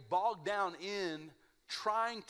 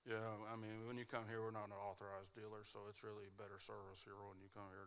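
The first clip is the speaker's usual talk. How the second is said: low, much softer, fast mumble; mumbling salesman; 8 semitones lower, 15 dB quieter, faster than usual. Retold or imitated. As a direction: barely open your mouth. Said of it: "this guy would not open his mouth".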